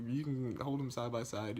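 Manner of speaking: ordinary delivery, nothing marked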